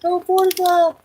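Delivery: high sung-out echo; echoing jingle. Pretend. Echoing his buddy's fake sponsor line, high and sung. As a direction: repeat it like a jingle.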